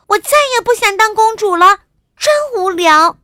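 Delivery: high, fast, loud princess shout. A narrator's reading. Princess fed up with royal life, shouting loudly. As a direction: yell it out like a fed-up little princess. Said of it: "她大声地喊道".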